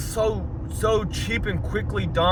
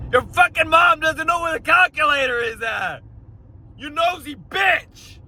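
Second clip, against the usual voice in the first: yelling across the room; 11 semitones higher than usual. Real, not acted. Yelling at movie character.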